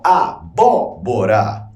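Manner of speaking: abóbora said slowly, split into syllables; slow classroom syllable split. Shown as a demonstration. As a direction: break abóbora apart, stress on bó. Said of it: "ó, se eu separar".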